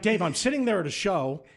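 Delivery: high-pitched mock scolding; angry boss. Station owner scolding his intern the next morning, indignant. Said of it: "chewed my ass".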